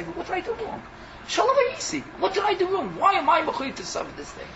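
high, plaintive protest; lightly voiced victim asking what he did wrong; innocent goat protesting. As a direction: ask why you were sacrificed.

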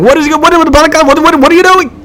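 high, loud overlapping-style stammer; imitated clamour, very high and loud; crowd shouting. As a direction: shout like a whole alarmed crowd at once. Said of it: "Everyone started shouting".

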